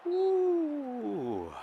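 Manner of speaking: sudden high, soft "ooh"; high gasp of suspense. Real, not acted. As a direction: wince at a near miss. Near miss in the game.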